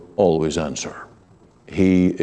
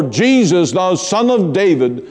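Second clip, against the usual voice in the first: raised, higher calling out to Jesus; crying out; 8 semitones higher than usual. Retold or imitated. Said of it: "they cried out all the more".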